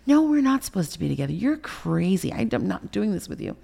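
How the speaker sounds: high, exasperated pushback; heated re-enacted argument; retold angry refusal. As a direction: fire back at him with pitch jumping up. Retold argument, rejecting boyfriend.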